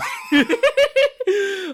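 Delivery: sudden high, loud burst of laughter; laughing burst. Real, not acted. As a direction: cracks up at the memory of the scene. Cracks up retelling the story.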